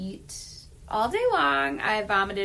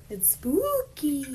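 spooky voice; 6 semitones higher, slower than usual. Pretend. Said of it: "I was gonna do, like, a scary voice".

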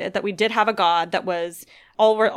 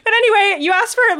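teary; 11 semitones higher, 6 dB louder than usual. Real, not acted. Recovering from tears, voice high.

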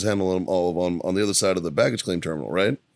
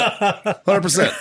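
Hundred percent drawn out slowly, much higher, through laughter; laughing; 9 semitones higher, 4 dB louder, much slower than usual. Real, not acted. Confirming the cock slap ruling to friends, laughing. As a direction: stretch Hundred percent out while cracking up.

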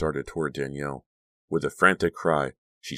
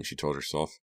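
usual speaking voice

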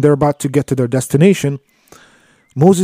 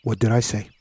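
quiet rebuke; 5 semitones lower, 9 dB quieter, faster than usual. Retold or imitated. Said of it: "Khidr looked at him and he said".

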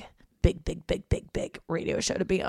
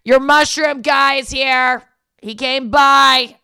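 higher, slower, louder mom announcing the mushroom guy; 8 semitones higher, 11 dB louder, slower than usual. Retold or imitated. Mom telling her daughter the mushroom guy came, in a mimicking voice. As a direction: put on your mom's voice, drawn out. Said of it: "my mom's like".

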